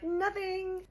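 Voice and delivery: very high, coy squeak of denial; exaggerated high-pitched coyness; playing innocent. Repeating her coy denial.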